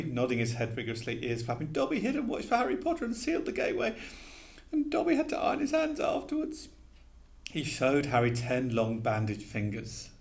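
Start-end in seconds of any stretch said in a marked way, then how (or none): 1.7-6.7 high squeaky elf voice, anxious; a fretful little elf begging; pleading house elf. A narrator's reading. Dobby confessing.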